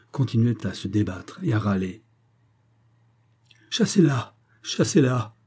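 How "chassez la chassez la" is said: higher-pitched, urgent cry for help; frightened cry. A narrator's reading. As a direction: plead with servants to drive it off. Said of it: "criait-il en faisant des efforts".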